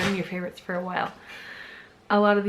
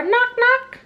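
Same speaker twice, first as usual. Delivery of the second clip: two high-pitched knock words; door knock imitation; 6 dB louder than usual. Reader shows a child door sounds, knocking imitation. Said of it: "he can sound like a door".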